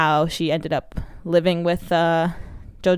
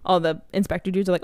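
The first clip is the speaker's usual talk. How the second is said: ordinary talk, nothing marked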